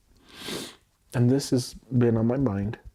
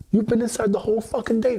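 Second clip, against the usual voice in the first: mock granny yell; 13 semitones higher, 4 dB louder, faster than usual. Voicing granny's scolding, higher.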